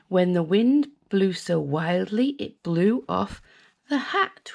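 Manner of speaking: ordinary delivery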